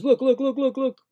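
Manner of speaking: high, teasing chant; mock the bigger guy; lightly voiced taunt; taunting look look. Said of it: "and he goes".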